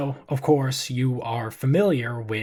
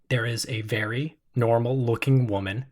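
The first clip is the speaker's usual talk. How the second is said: ordinary delivery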